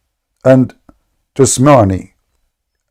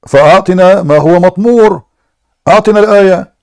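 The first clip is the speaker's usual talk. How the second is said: higher, pressing challenge; insistent demand; 5 semitones higher than usual. Real, not acted. Debater presses opponent to recite the verse, insistent and higher. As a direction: corner him, demand it.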